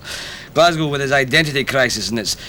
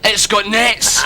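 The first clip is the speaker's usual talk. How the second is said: higher, louder tearful wonder; higher, louder joyful awe; tears of joy; 9 semitones higher, 4 dB louder, much slower than usual. Retold or imitated. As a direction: Moses overwhelmed with joy. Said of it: "tears of joy in his eyes".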